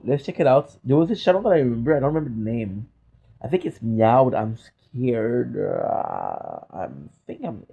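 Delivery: usual speaking voice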